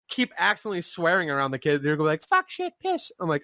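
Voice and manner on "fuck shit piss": put-on very high little-kid voice; kid parroting swears. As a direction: chirp the swears like a toddler.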